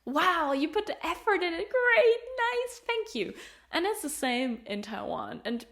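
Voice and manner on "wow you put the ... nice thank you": imitating delighted locals. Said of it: "people are like".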